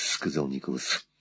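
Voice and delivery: ordinary delivery, nothing marked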